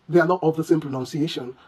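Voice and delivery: ordinary talk, nothing marked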